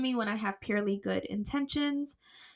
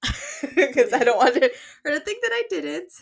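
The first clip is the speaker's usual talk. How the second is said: laughing; 6 semitones higher, 8 dB louder, slower than usual. Real, not acted. Laughs while explaining.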